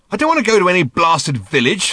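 loud, high angry snap; snapping. Acted. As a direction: bite the words off in anger. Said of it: "snapped Ford".

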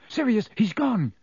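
urgent high-pitched yell; Harry. A narrator's reading. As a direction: yell the alarm to a friend, voice pitched well up. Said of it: "Harry yelled".

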